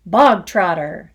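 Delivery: loud, slow barked name. Acted. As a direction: bark the name across the hall. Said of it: "the Trunchbull barked suddenly".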